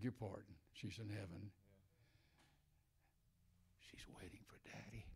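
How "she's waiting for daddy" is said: broken whisper, almost no voice; tender whisper of a grieving father. Real, not acted. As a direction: breathe it out, barely audible. Grieving father about daughter.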